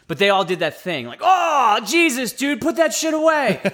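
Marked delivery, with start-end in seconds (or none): 1.2-3.6 high-pitched, horrified exclamation; mock disgusted outcry. Soldiers reacting to his injury, appalled outcry. Acts out soldiers recoiling at the sight.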